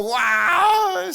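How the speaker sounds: a high, hammed-up crying wail of a lost child; mock sob-shout of a lost child; mock child's wail. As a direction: wail like a scared little kid finding his mom. Lost child finding parent, crying.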